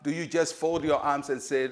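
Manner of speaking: normal talking voice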